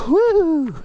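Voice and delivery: burst of a whoop. Real, not acted. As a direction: push yourself with a shout. Cheering himself on while running.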